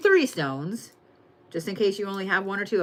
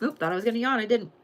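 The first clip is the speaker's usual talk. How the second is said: ordinary talk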